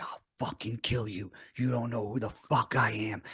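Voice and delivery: imitating the stranger's quiet, low threat; menacing threat. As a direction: growl it low like a retold threat. Stranger threatens holding dumbbells.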